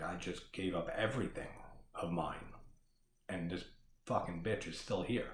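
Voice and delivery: quietly voiced bitter thought; muttered inner thought. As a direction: mutter it like a defeated sigh to yourself. Voicing Emily's thoughts, under breath.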